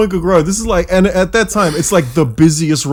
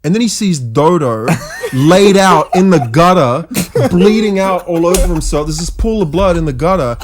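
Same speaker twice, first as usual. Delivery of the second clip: ordinary talk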